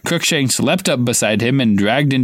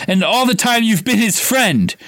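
higher, slow, voice cracking with fury; shouting, voice wavering; 10 semitones higher than usual. A narrator's reading. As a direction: lose control of the voice in rage. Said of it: "his voice wavering out of control".

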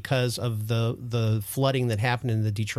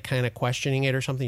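ordinary talk, nothing marked

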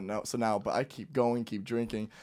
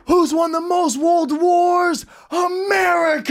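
high, slow shouted quiz to the crowd; rally the balcony crowd with a booming call; retold crowd shout; 4 dB louder, much slower than usual. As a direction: yell it out to the party like a hype man. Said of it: "I was like".

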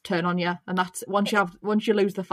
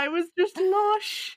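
gushing; 9 semitones higher, slower than usual. Real, not acted. Gushing about the launch.

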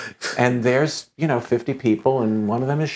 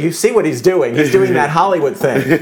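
raised, louder voice making his point; emphatic retold retort; 6 semitones higher, 7 dB louder than usual. Telling the producer, raised and emphatic. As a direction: insist loudly that it's a Hollywood trick. Retelling his own argument.

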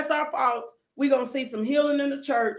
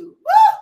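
a sudden high, loud "woo"; high whooping shout; 11 dB louder than usual. Real, not acted. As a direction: let out a joyful whoop. Preacher overcome, shouting out.

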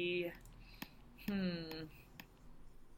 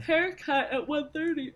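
yawning; 8 semitones higher, 6 dB louder than usual. Real, not acted. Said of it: "Oh, sorry, I'm yawning".

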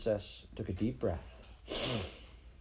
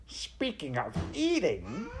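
high, slow, sly call; shouting rat; 7 semitones higher, slower than usual. Acted. Hungry rat interrupts the princess, sly call. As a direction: swoop up greedily on the last word. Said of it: "shouted one of the rats".

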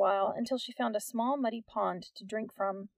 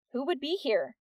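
loudly; 5 semitones higher than usual. A narrator's reading. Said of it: "Nix said loudly".

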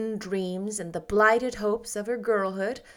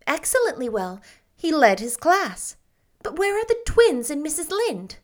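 put-on higher, eager young woman; bright, breathless girlish rapture; Anne's voice; 6 semitones higher, 4 dB louder than usual. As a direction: gush like a dreamy, happy girl.

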